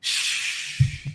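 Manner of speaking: winded exhale, like being hit in the stomach; demo sigh. Shown as a demonstration. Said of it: "you just go".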